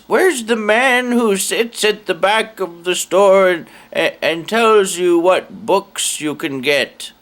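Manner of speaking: put-on high, slow, creaky old lady. Very old lady asking the clerk for help, high and slow. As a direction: pitch it up like a frail old woman.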